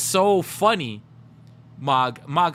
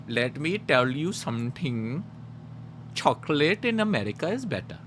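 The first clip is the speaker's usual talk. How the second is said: mock Indian accent; 4 dB quieter than usual. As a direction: imitate an Indian guy setting someone straight. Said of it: "And then an Indian guy".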